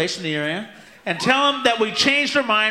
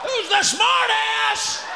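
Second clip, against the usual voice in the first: mock shocked victim. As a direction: yelp like a man ambushed on the toilet. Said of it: "sit on a toilet seat and go".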